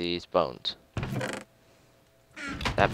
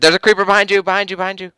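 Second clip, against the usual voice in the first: high, loud urgent warning; shouting orders over battle; 12 semitones higher, 10 dB louder, faster than usual. Real, not acted. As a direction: scream the warning at a teammate. Warning a teammate of a creeper.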